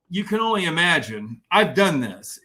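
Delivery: ordinary talk, nothing marked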